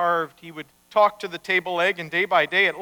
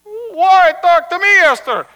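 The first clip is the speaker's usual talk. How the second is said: loud protest; 10 semitones higher, 11 dB louder than usual. Acted. Rabbi answering his angry wife, protesting loudly.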